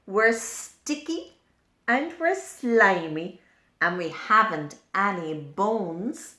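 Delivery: proud slimy slugs showing off; slug characters. Acted. Slugs speak in the book.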